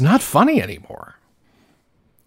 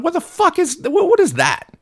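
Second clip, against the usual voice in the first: disgusted surprise. Retold or imitated. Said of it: "I thought".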